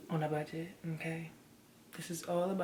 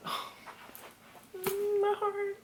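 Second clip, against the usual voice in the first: touched. Real, not acted. Touched by a sentimental gift.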